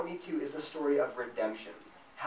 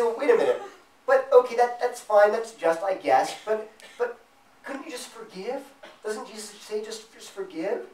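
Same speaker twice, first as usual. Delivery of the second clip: higher, pleading objection, lightly voiced; protesting listener; 6 semitones higher, 4 dB louder than usual. Preacher voices a listener objecting to justice, higher and pleading. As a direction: push back like a doubtful listener.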